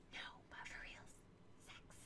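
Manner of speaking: breathy whisper, just for the camera; joking whisper. Pretend. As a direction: whisper it like a naughty secret. Cheeky whispered joke to camera.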